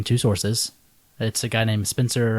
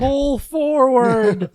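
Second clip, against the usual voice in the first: high, slow, loud speaker voice; drive thru speaker; 12 semitones higher, 6 dB louder, much slower than usual. Acted. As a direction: talk through a tinny drive-thru box.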